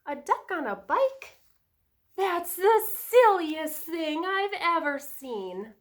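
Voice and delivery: a touch of storybook disbelief; incredulous cow. A narrator's reading. Cow's thought, read to children.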